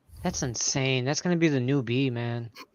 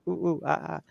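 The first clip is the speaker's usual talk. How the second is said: hooting giggly monkey; 3 semitones higher, 7 dB quieter, faster than usual. Retold or imitated. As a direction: hoot it quietly, half to yourself. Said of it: "I'm like".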